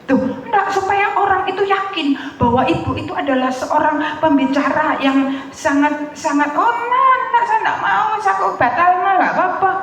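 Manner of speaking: acted-out high-pitched back-and-forth; insist, then refuse, in a high voice; insistent protest. Acts organiser insisting, her refusing.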